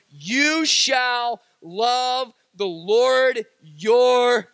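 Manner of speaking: slow, weighty reading of the verse, words stretched; solemn scripture reading. Acted. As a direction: read it like holy law, slow and loud. Reading Scripture aloud to congregation.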